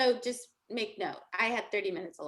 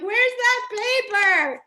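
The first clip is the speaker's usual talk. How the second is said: high, very loud, stretched-out words; mock-frantic wail; 9 semitones higher, 10 dB louder, much slower than usual. Retelling her parking-lot search for the paper, mock-frantic wail. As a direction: wail it in comic desperation, dragging each word.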